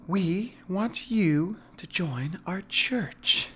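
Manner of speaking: high, very slow seductive woman; slow, flirty feminine voice; Veronica. A narrator's reading. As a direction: draw each word out as a sultry invitation. Veronica repeats invitation seductively.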